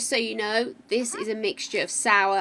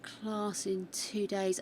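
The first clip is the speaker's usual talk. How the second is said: ordinary delivery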